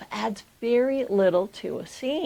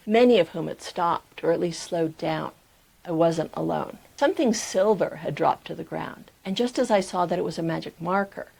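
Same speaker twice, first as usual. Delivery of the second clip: lower, measured audiobook reading; story narration; 4 semitones lower than usual. A narrator's reading. Writing coach reading an excerpt to viewers, low measured narration. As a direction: read the memoir aloud in a low register. Reads memoir excerpt aloud.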